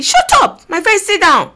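high, loud mock scolding; acted scolding. Crowd silencing the blind beggar, loud acted scolding. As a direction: shoot it out high to silence someone.

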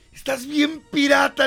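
mocking shout. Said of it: "volteé y le dije".

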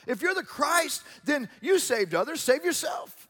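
high, shouted taunt of the rulers; jeering challenge. Retold or imitated. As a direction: shout it as a mocking dare. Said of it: "what they were trying to show".

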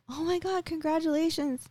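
high-pitched congratulating coworker; imitated delight. Coworker reacting to pregnancy test.

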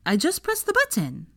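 put-on high small boy's voice; child voice. A narrator's reading. Teddy telling Hermione he pressed the button, high and childlike. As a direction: speak up like an eager little boy.

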